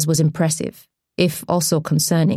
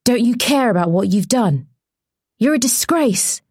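accusing reporter; 5 semitones higher than usual. Acted. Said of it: "repeated the man with the shoes".

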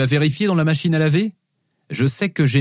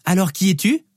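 put-on high-pitched child's question; little girl asking; 6 semitones higher than usual. Daughter playing along with dad, high questioning child voice. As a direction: ask in a small girl's voice.

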